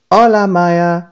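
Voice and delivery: high, loud calling greeting. A narrator's reading. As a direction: call out to a far-off dinosaur. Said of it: "Diego called".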